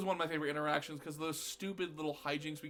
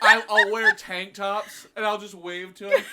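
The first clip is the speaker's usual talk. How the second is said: laughing; 3 semitones higher, 12 dB louder than usual. Real, not acted. Imagining embarrassing kids, laughing.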